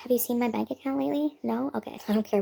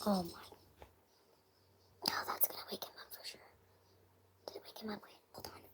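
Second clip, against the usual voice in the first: hushed and worried, barely voiced; whispering so a sleeper stays asleep; real hushed whisper; 13 dB quieter than usual. Real, not acted. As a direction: whisper so you don't wake him. Someone asleep, cat made noise.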